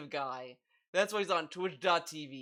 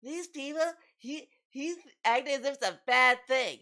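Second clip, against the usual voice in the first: high, louder mock whine; mocking DSP; 7 semitones higher, 5 dB louder than usual. Mocking DSP's complaint about viewers, high loud mock whine. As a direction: parrot him in a high indignant whine. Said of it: "And he's like".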